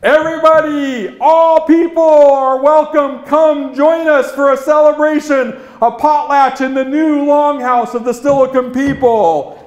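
loud, high, long-carried call; high ceremonial summons to all who can hear. Acted. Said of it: "he completed calling out".

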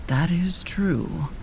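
slow, breathy whispered agreement. A narrator's reading. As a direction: whisper it slowly. Scarecrow agrees softly.

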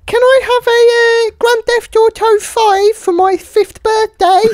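put-on a five-year-old begging mummy for a game; small child.

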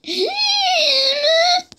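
piercing high squeal of a laugh; high shriek laugh. Real, not acted. Gamer startled by the game, shrieking laugh.

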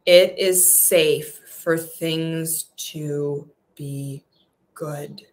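very slow affirmation fading out; drawn-out intention. Shown as a demonstration. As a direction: stretch it out and let "good" fall away. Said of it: "say it with intention".

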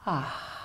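breathy whispered ah sigh; soft sigh. Shown as a demonstration. Teacher showing students a soft release sigh. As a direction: let a soft sigh slip out. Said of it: "that's a different breath".

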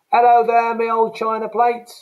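loud, high Cockney hail; loud Cockney greeting. Shown as a demonstration. Teacher greeting an imagined mate in Cockney, loud and high. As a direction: call out to your old mate, cheerily.

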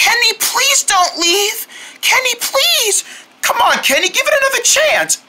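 whiny, stretched-out plea; pleading. Acted. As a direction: beg a friend to stay. Begging friend not to leave.

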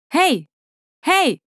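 narrated shout to get attention; calling out. A narrator's reading. Rescuer outside calls to those in the lift, calling out.

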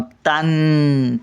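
"तां-," slow, held on the "n"; अनुस्वार लांबवून. Shown as a demonstration. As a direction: stop on a long humming taan. Teacher isolates the nasal syllable. ("तां-," नासिक्य ध्वनी लांबवून)

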